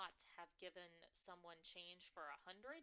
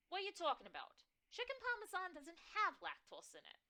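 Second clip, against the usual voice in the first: high, loud, scandalized New York mom; outraged on her daughter's behalf; indignant New York mother; 12 semitones higher, 10 dB louder, faster than usual. Acted. Mother complaining about the boss, loud and shrill. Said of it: "My mother's shrill, New York-tinged voice".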